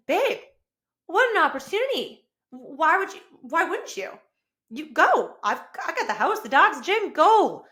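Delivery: high, loud, eager encouragement to her husband; excited cheering on. Retold or imitated. Wife urging husband to go on the trip, excited and high. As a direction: push him out the door with delight.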